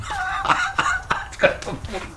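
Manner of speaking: high-pitched, quiet real laugh. Real, not acted. Man amused by his own mad builder story, laughing. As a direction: burst into a soft, high laugh.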